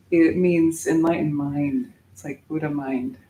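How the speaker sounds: ordinary talk, nothing marked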